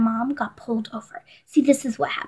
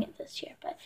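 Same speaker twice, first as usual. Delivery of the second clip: whisper; 23 dB quieter than usual. Real, not acted. Whispered aside.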